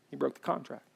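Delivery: He broke the contract, said softly and plainly; quiet restatement. Shown as a demonstration. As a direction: drop the voice and just name it. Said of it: "You're mad at God".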